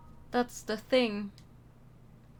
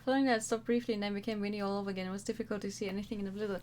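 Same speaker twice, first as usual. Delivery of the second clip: normal talking voice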